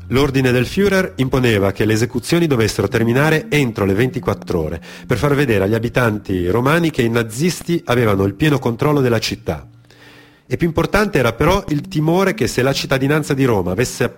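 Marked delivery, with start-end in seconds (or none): none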